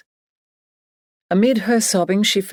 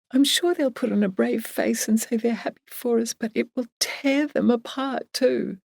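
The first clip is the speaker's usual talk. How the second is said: worried Emily; 7 semitones higher than usual. A narrator's reading. Emily frets over their grieving friends, worried and high.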